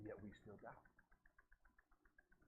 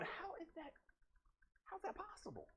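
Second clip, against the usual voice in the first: pitched way up and soft, baffled; disbelief; 9 semitones higher, 14 dB quieter than usual. Retold or imitated. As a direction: ask it like your mind can't take it in. Preacher baffled by the miracle.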